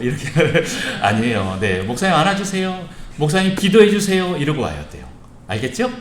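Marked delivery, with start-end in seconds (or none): 1.8-4.4 put-on high, lightly childlike asking; child's request. Shown as a demonstration. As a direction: model a kid's eager plea to the pastor. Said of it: "우리 어린 친구들 목사님 보면". (아이처럼 살짝 높은 목소리로 부탁하듯)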